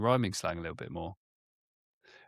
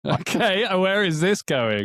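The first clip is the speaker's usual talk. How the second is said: amused and laughing, higher and louder; 9 semitones higher, 7 dB louder, slower than usual. Real, not acted. Host amused by his co-host's slang test, laughing. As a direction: chuckle through the question.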